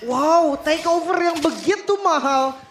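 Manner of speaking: amazed hype. Real, not acted. Co-caster amazed after play.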